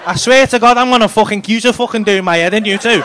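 ordinary talk, nothing marked

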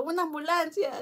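friend's panicked yell for help, high and loud; shouting for an ambulance; retold shout. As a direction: call out for an ambulance in alarm. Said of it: "ese amigo estaba gritando".

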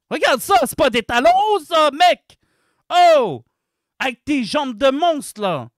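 heated, incredulous outburst; outraged shouting. Real, not acted. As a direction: yell in exasperated protest. Outraged rant at the legs.

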